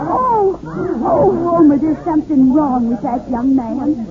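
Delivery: high, fluttering cries of alarm; startled old lady. Acted. Matilda alarmed as the sleeping man falls into the street. Woman sees man fall.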